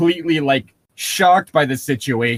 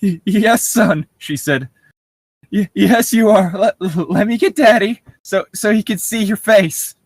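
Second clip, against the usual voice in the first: stammering scared mother; 6 semitones higher than usual. Acted.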